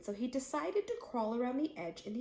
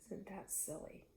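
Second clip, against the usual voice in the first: soft amused aside; 12 dB quieter than usual. Real, not acted. Reader's aside to children.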